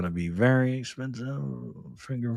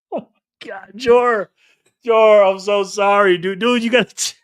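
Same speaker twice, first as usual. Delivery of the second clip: high, slow, breaking into laughter; giggling through a sheepish sorry, dude; laughing apology; 7 semitones higher, 8 dB louder, slower than usual. Real, not acted. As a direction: say sorry while you can't stop laughing. Apologizing to Jor, cracking up.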